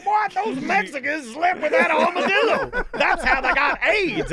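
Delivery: high, loud, slow ranting dad; hammed-up imitation of a preaching father; biblical dad. Dad explaining animal diseases to son, hammed-up righteous rant. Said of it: "My dad was very biblical".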